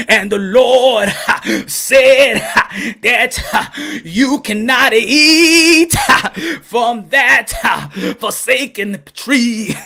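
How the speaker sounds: slow, breaking into laughs between words; can't hold the preacher voice for laughing; laughing through it. Acted. Preacher retelling Genesis to the crowd, cracking up mid-sentence.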